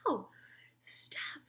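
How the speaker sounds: normal talking voice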